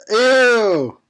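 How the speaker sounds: high, loud grossed-out ew; disgusted ew. Real, not acted. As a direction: recoil from the sight. Gross-out at Speedo.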